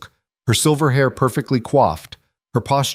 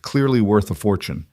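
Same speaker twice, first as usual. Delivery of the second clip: ordinary delivery, nothing marked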